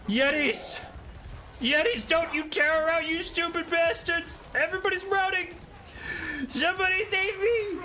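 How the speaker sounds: very high, frantic cries for help; panicked unit voice. Pretend. Doomed unit screams at fleeing Yetis, very high and frantic. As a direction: shriek like a soldier as the army routs.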